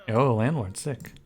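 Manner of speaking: ordinary delivery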